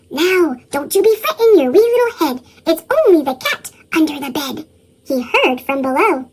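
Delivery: usual speaking voice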